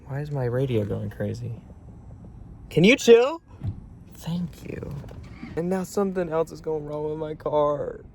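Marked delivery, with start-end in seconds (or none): 2.7-3.4 high, loud exasperated outburst; loud, high plea to stop. Real, not acted. Snapping at noisy car radio. 4.1-5.0 near-whispered relief; soft, breath-only thank you; hushed thanks. Real, not acted. After the radio quiets.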